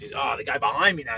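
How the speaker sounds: ordinary delivery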